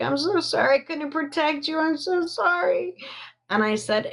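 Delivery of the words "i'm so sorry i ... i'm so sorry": much higher, quick, tearful pleading; retold mom's tearful apology, voice climbing high; imitating mom sobbing. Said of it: "And she was crying".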